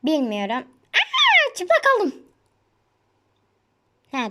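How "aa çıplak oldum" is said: surprised yelp. Real, not acted. Sudden shock in game.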